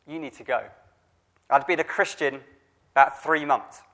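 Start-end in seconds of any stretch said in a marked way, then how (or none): 0.1-0.6 little inner voice. Retold or imitated. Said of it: "a little voice that said".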